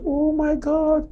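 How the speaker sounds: high, slow oh my God; a stunned old auntie recognising a visitor; a mild mock cry of surprise; shocked exclamation. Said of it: "And she shouted".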